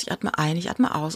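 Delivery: a quiet breathing mantra to herself; fading meditative breath count. Retold or imitated. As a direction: let the count sink down to a murmur. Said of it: "bei der Meditation zu sagen".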